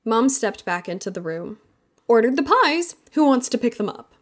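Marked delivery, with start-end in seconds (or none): 2.1-4.1 high, louder announcing voice; higher, louder upbeat mom; cheerful mom. Acted. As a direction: mom calling out news to the family. Mom returns announcing pies.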